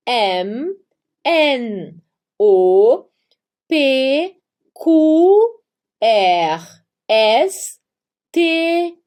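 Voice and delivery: letters one by one, drawn out, paused; alphabet recitation. Shown as a demonstration. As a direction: recite the German alphabet for learners to repeat. (recitando o alfabeto alemão, letra por letra)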